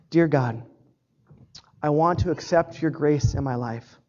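low, measured prayer with long pauses; leading prayer. Real, not acted. Preacher leading the congregation to accept grace, low prayer. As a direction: pray line by line, leaving room to repeat.

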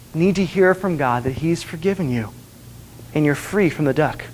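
normal talking voice

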